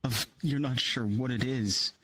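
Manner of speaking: soft, hushed scene-setting; low-key suspenseful narration; game master narration. A narrator's reading. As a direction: draw the players in quietly.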